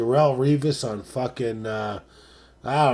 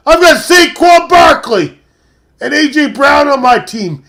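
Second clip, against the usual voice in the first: much higher and louder, yelling the names; excited shout; 10 semitones higher, 10 dB louder than usual. Real, not acted. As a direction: brag at the top of your lungs. Bragging about his players.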